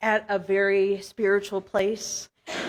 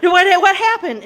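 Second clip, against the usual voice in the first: feigned alarm; 8 semitones higher, 6 dB louder than usual. Pretend. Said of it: "not wanting to give it away that it was me".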